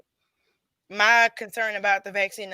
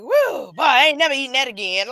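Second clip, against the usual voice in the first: relieved shout; 4 semitones higher, 7 dB louder than usual. Retold or imitated. Acting out gut relief.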